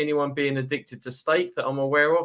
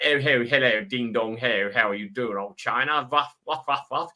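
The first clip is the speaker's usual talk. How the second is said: hammed-up posh upper-class British accent, dipping lower; mock Boris posh accent; 3 semitones lower than usual. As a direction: play a jokey toff British gent with a silly posh English accent. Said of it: "as a good old Boris would do".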